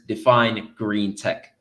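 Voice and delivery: usual speaking voice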